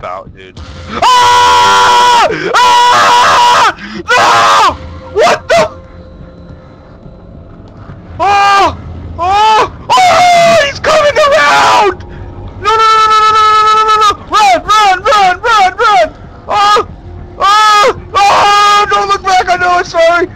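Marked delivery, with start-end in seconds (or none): 1.0-12.0 high panicked screams; terrified screaming. Real, not acted. Horror game jump scare. 12.6-20.3 frantic high shouting while fleeing; being chased. Real, not acted. Fleeing a monster in game.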